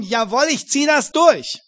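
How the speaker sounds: Pilate's loud inner vow; resolute Pilate. Retold or imitated. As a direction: declare it with grim determination. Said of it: "hat er sich gewissermaßen vorgenommen".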